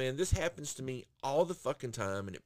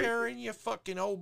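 mock insult; 9 semitones higher than usual. Insulting Karen directly.

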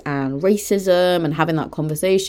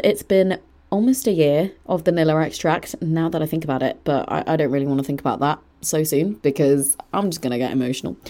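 ordinary talk, nothing marked

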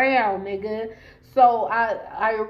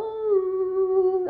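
emotional ooh; 8 semitones higher than usual. Real, not acted. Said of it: "I'ma start crying".

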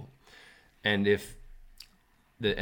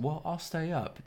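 coaxing crocodile; 9 semitones higher than usual. Acted. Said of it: "the crocodile said".

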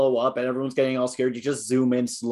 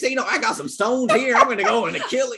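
much higher, hammed-up southern accent; exaggerated southern accent; 9 semitones higher than usual. Demonstrating the villain's voice to friends in a high southern accent. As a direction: show your friend how it's done in an over-the-top southern accent. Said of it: "it's like this".

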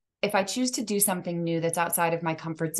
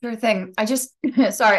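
usual speaking voice

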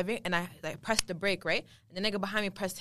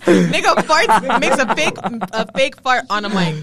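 louder, higher, slow words through laughter; can barely get it out for laughing; cracking up while roasting a friend; laughing while talking; 3 semitones higher, 12 dB louder, slower than usual. Real, not acted. Teasing friend about fake fart.

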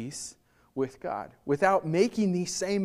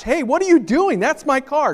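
acted yell, higher and louder; yell from the garage; 7 semitones higher, 8 dB louder than usual. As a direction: shout at the car thief from the garage. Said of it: "Somebody's gonna yell from the garage".

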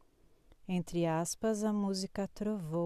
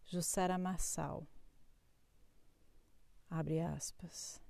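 poetry reading; 3 dB quieter than usual. Acted. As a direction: read it like a spoken poem. Reads a poetic horoscope.

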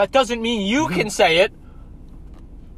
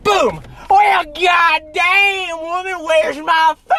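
hillbilly dad yelling, drawn out; appalachian accent; 8 semitones higher, 3 dB louder, much slower than usual. Retold or imitated. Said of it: "like a hillbilly meth addict".

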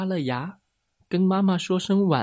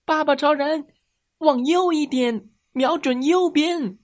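put-on high, urgent child's call; shouting directions; 11 semitones higher, 4 dB louder than usual. A narrator's reading. Boy guides Daddy Superman's aim, high acted shout. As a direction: yell your aiming tips to the hero. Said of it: "我大叫道".

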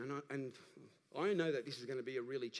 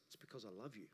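much softer, lower, hushed answer; God's voice; 6 semitones lower, 15 dB quieter, faster than usual. Acted. God answering the church's question, hushed and soft. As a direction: let God speak it tenderly, almost a whisper. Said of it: "His resounding, deafening voice".

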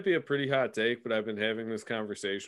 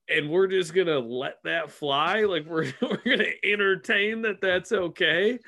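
incredulous retort; 8 semitones higher, 5 dB louder than usual. Retold or imitated. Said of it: "I'm like".

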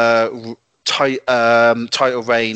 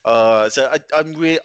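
ordinary talk, nothing marked

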